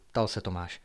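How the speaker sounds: ordinary delivery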